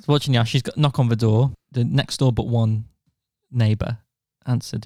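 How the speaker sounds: normal talking voice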